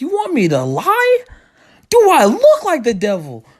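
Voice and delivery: high, slow, scandalized refusal; outraged disbelief. Retold or imitated. Kid refusing to lie to mom.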